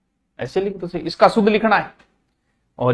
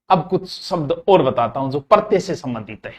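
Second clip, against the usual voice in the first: ordinary talk, nothing marked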